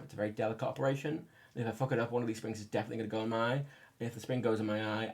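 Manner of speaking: normal talking voice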